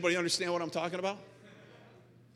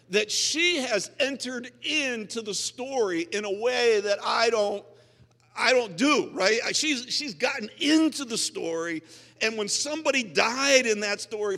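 higher, louder, driving sermon voice; full-force preaching; 8 semitones higher, 9 dB louder than usual. Acted. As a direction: preach it out with rising passion.

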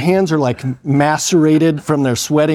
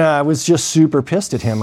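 normal talking voice

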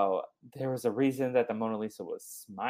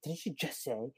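quiet; 2 semitones higher, 10 dB quieter than usual. Retold or imitated. Background extra whispering.

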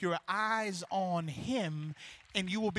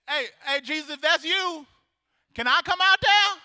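Peter calling out; 10 semitones higher, 13 dB louder than usual. Acted. Peter calls to Jesus.

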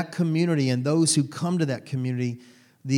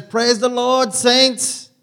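put-on high, loud, exuberant church-lady accent greeting the saints; imitating Angela's accent; 9 semitones higher, 7 dB louder, much slower than usual. Angela greeting the worship team on arrival, in a booming accented imitation. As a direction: belt it out in her accent as she enters. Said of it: "I wish I could do that accent".

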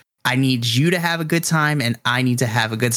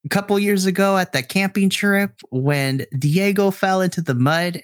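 higher, doting mother voice; mom mode; 6 semitones higher than usual. Acted. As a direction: gush like a proud mom. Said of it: "she begins to go into mom mode".

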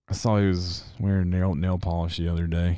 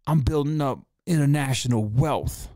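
imitating [name]; 5 semitones higher than usual. Podcaster parodying [name] bragging about wealth, hammed-up. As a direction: brag it up like a self-impressed mogul. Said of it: "He's like".

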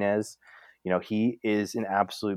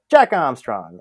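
punched loud, falling name call; mock sportscaster shout; broadcaster impression; 3 semitones higher, 8 dB louder, slower than usual. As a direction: belt out the name like an excited announcer.

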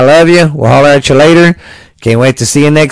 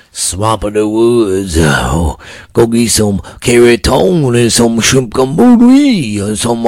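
slow, hammed-up Louisiana drawl; bad Louisiana impression; slower than usual. Host teasing his guest, in a bad slow Louisiana accent. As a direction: lay on a clumsy Louisiana accent, slowly. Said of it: "really shitty Louisiana impersonation".

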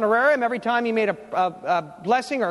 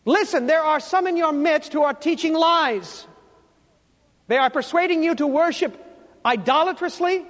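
stern, forceful rebuke, pitched up; Jesus; 4 semitones higher than usual. Acted. Jesus condemning the church of Pergamum for tolerating false teaching, sternly forceful. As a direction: declaim it like an indignant divine indictment. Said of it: "I believe this is what he is saying".

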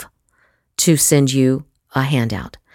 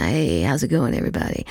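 too casual; 4 semitones lower, 6 dB quieter, faster than usual. Shown as a demonstration. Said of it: "you just kinda".

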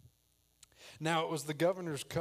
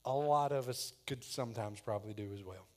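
usual speaking voice

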